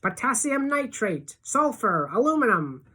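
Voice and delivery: high, ringing calls, one name at a time; thrilled list called out high; excited shouting. A narrator's reading. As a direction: shout out each name in high-pitched delight. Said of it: "Freak keeps on shouting out the names".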